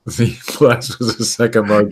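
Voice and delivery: news read cracking into laughter; trying not to laugh. Real, not acted. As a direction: read on through a laugh you can't stop. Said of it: "You're never gonna be able to stop".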